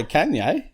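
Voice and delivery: "[name]" with the a of "can", high-pitched; high, incredulous Can-yay for "[name]"; Can-yay mispronunciation. Shown as a demonstration. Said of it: "You don't say [name]".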